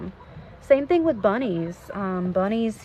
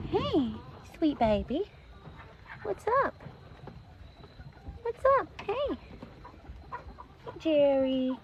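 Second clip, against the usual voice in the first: high pet greeting; 8 semitones higher, 8 dB quieter than usual. Real, not acted.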